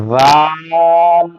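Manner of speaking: زال stretched slowly; drawn out. Shown as a demonstration. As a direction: hold زال long. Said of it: "کھینچ کے پڑھ رہا ہوں". (ذال کو کھینچ کر پڑھنا)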